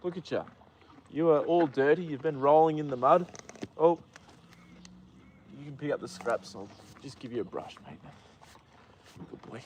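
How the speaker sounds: quiet and gentle, fussing over a muddy horse; soft talk to horse. Real, not acted. Groomer softly talking to his muddy horse, gentle. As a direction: murmur to him like a fond owner.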